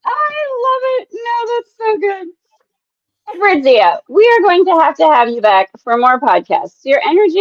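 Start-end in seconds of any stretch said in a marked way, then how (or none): 0.0-2.3 laughing. Real, not acted. Host laughing at the guest.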